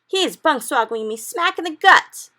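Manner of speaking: very loud, high-pitched complaint; full-force startled protest; indignant shout. A narrator's reading. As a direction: shout it like a giant kicked awake. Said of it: "shouted the gizzard gulper".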